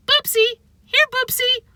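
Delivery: high sing-song calling; old lady calling a cat. A narrator's reading. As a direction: call the cat from afar. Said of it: "the woman called out".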